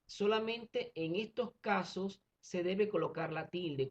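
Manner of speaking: usual speaking voice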